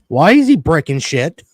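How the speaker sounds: ordinary talk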